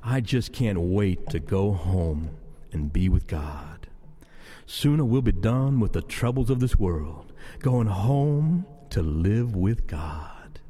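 very low, slow, drawn-out yearning; low reverie, trailing into breath; longing for God. Acted. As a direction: stretch the words out with deep longing. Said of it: "But I sometimes say this".

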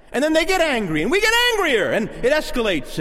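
escalating anger. Retold or imitated. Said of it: "coming back at them with venom".

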